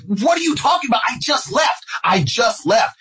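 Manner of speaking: very high-pitched, incredulous outcry; shocked protest. Retold or imitated. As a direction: protest in disbelief, voice shooting way up. Retold shocked reply to brother.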